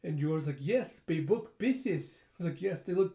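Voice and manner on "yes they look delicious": slow, higher, garbled through a mouthpiece; muffled, mushy consonants, drawn out; snorkel-mask talk. Retold or imitated. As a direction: lisp it with a snorkel in your mouth. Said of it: "with her snorkeling mask".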